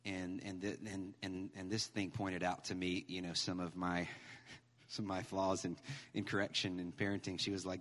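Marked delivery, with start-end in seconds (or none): none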